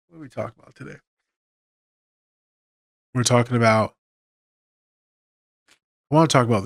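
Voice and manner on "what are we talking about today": much softer repeat, to oneself; quiet self-questioning. Real, not acted. Host finding the thread after an emotional recap, hushed. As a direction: ask it under your breath.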